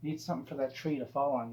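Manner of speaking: ordinary talk, nothing marked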